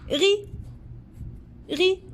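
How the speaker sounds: rit said high, as "ri", the T left silent; silent T, modelled high. Shown as a demonstration. Teacher shows children the silent T in rit, high. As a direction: sing out rit, no T at the end. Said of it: "le T, on la prononce pas".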